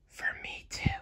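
soft unvoiced whisper; a tender sleepy-time whisper. A narrator's reading. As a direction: whisper so she won't wake. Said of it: "Elsa whispered".

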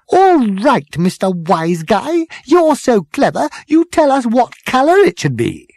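high, loud, sarcastic retort; strident, challenging girl. A narrator's reading. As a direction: throw the challenge back stridently. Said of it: "the girl with the strident voice".

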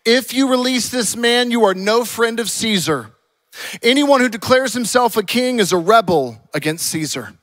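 loud, high accusing declamation; acted shouting. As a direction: shout it as a public warning.

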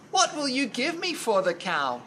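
put-on high, quick boy's voice; young boy. Jack asks the man about the cow, high boyish voice. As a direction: lift it into a positive boy's voice. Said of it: "a little high and like a boy".

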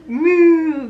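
playful high moo; cow moo. Acted. Echoing toddler's cow sound.

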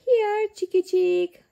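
high sing-song call to the chicks. A narrator's reading. Peppa calling chicks at the petting farm, high call. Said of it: "calls Peppa".

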